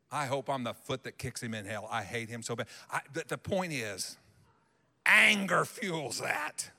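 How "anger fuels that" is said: ordinary talk, nothing marked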